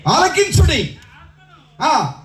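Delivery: ringing scripture proclamation; full-force preaching. Real, not acted. Preacher proclaims God's promised teaching, full-force preaching. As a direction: call out the verse with force.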